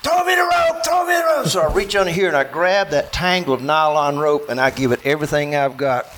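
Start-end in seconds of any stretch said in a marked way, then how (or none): 0.0-1.5 high, strained repeated yell; screaming plea. Retold or imitated. Buddy thirty yards away wanting the rope, screamed plea. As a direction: call out desperately over rushing water. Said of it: "and he's screaming at me".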